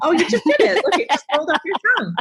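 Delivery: high, fast laughing tease; giggling through the words. Real, not acted. Teasing after mispronounced Connecticut.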